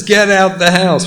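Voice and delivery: mock exasperated wife, loud; firm loud order. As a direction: get out the house, said high and loud. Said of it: "She just says".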